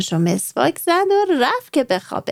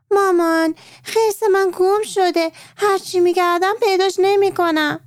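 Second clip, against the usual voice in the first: high shout; 9 semitones higher than usual. Acted. Maryam calling downstairs to her mother, high shout. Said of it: "داد زد".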